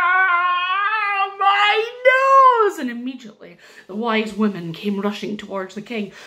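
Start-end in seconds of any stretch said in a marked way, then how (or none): 0.1-2.8 high, loud, drawn-out wail; pained yell. Acted. Stung king clutching at his nose, pained yell. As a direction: howl it out in mock agony.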